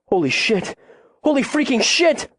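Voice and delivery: high-pitched shock, climbing sharply; alarmed outburst. A narrator's reading. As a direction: blurt it in rising panic. Boy sees the cougar.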